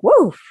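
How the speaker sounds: high, exclaimed whoosh of alarm; startled "woof". Retold or imitated. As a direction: imitate dodging a near miss. Retold reaction to rebounding axe.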